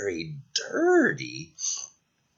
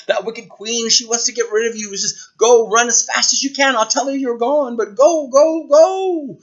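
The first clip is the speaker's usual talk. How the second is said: urgent warning; 9 semitones higher, 4 dB louder than usual. Acted. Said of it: "so he told her".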